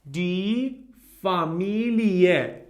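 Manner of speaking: Familie drawn out slowly, fa-MEE-lee-eh; slow model word. Shown as a demonstration. Teacher repeating Familie slowly for students, drawn out. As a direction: stretch out Familie syllable by syllable. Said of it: "We read everything we see".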